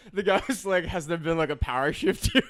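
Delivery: high and loud through laughter; can barely get the quote out; laughing. Real, not acted. Laughing while quoting the customer to friends.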